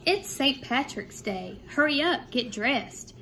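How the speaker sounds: lively sing-song picture book reading; bouncy storytime voice with a lifted refrain; reading to children. A narrator's reading. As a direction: read the rhymes aloud to a second grade class. Reads picture book to class.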